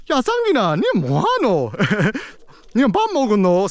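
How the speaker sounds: high, swinging Busan-dialect Korean; Busan uncle accent. Retold or imitated. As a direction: imitate a Busan uncle's up-and-down Gyeongsang intonation. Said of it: "My Busan uncle is like".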